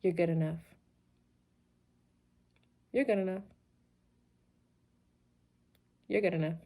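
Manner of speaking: soft, hesitant, unconvinced repetition; timid murmur. Shown as a demonstration. Coach showing the wrong way to affirm, soft and unsure. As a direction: mumble it like you don't believe it. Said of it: "are we just gonna sit there and say".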